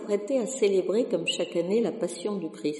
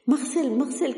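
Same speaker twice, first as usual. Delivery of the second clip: calling out higher and louder, out of breath from running; breathless cry; 7 semitones higher, 4 dB louder than usual. Acted. Sacristan bursts into the workshop calling Marcel, breathless. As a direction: burst in crying the carpenter's name. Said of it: "cria-t-il tout essoufflé".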